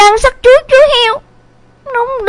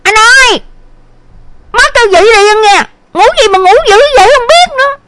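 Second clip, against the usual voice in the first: put-on higher-pitched girl's holler; loud yelling; 7 dB louder, faster than usual. A narrator's reading. Sister calls brother to get up, high exasperated yell. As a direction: yell through a door to rouse a sleeper. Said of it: "buồn rồi thét lớn".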